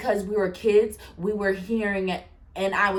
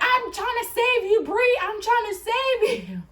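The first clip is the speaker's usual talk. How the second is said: very high-pitched pleading yell, imitated; hammed-up high desperate cry; mock yelling; 12 semitones higher than usual. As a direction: mimic him wailing in frantic protest. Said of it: "yelling at me like".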